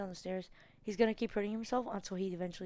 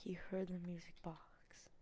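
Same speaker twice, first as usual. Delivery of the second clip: whispering; 2 semitones lower, 9 dB quieter than usual. Real, not acted. Hiding from monster, whispering.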